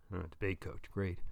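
low, soft, unimpressed dad; sarcastic father. A narrator's reading. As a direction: echo it with dry sarcasm. Father scoffs at son's answer.

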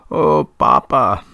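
slow, loud sobbing wail. A narrator's reading. Son weeps at his father's deathbed, loud sobbing wail. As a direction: break down over the body, slow and loud. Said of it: "sobbing loudly".

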